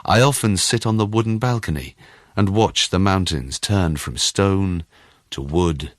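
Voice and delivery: ordinary delivery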